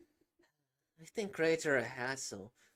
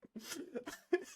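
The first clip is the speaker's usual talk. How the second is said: embarrassed laugh. Real, not acted. Laughs at botched ending.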